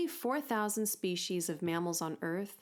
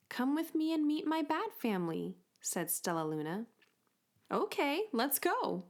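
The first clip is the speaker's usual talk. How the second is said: lightly voiced young creatures; 7 semitones higher than usual. Bat and bird voices.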